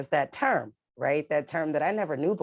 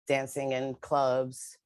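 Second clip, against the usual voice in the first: normal talking voice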